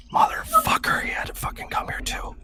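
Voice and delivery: hushed grumbling whisper; mock whispered curse. Booker whispering a curse about the kiss-ass. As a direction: curse under your breath.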